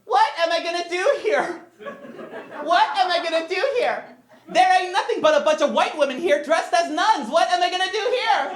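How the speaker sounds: high-pitched movie-character impression; Sister Act monologue. Boy recites Sister Act for mom's friends, high dramatic imitation. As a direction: recite a film rant in a put-on high voice. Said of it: "recite monologues from Sister Act".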